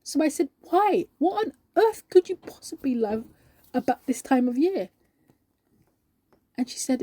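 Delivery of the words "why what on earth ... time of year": baffled. Acted. Asking colleague why she loves this season, baffled. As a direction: burst out in bewildered disbelief.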